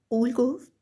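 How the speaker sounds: usual speaking voice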